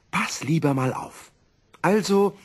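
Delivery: high, excited professor; eager demonstration. Acted. Professor proudly demonstrates the wish to his wife, high and eager. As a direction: show off the trick.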